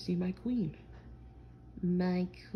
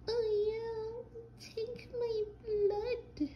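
much higher, swooning falsetto; teasing his girlfriend with a high, slow mock swoon; much slower than usual. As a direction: mimic her gushing in a squeaky voice. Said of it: "And then you'll be like".

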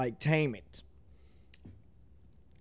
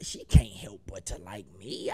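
whispered and lower, a sly secret brag; boastful whisper; 11 semitones lower, 5 dB quieter than usual. Real, not acted. Reactor bragging that women like him, sly boastful whisper.

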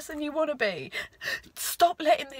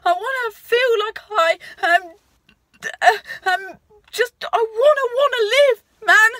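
choked up; 5 semitones higher, 6 dB louder than usual. Real, not acted. Talking to camera about wanting to live, tearful. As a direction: voice catching with tears, a bit higher. Tearful plea to take power back.

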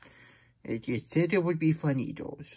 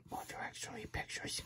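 whisper; 17 dB quieter than usual. Real, not acted. Muttered aside.

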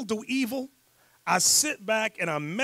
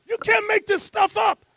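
much higher, loud disbelieving shout; exasperated shout; 12 semitones higher, 7 dB louder than usual. Real, not acted. Host repeating to the class, exasperated shout.

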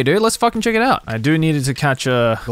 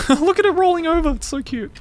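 cooing. Real, not acted. Cooing at a cute Pokemon.

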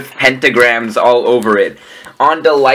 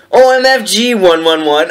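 raised, higher-pitched mock shout; 6 semitones higher, 3 dB louder than usual. A narrator's reading. Narrator reads a character's shouted reaction, mock shout. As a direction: call it out in a hammed-up read. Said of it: "I shouted".

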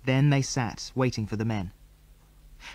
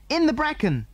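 shouted; 13 semitones higher than usual. A narrator's reading. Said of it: "shouted Jill".

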